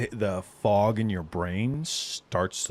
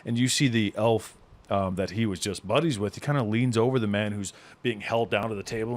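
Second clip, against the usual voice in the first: usual speaking voice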